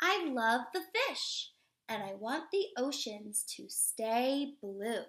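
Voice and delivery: slow, expressive storybook read-aloud; reading to children. Acted. Teacher reading a picture book to children, expressive read-aloud. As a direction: read it warmly to a class of little kids.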